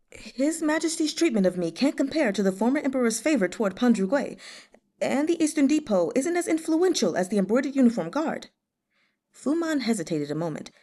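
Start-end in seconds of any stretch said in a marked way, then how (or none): 0.1-8.5 Fu Man's voice. Acted. Fu Man voiced higher.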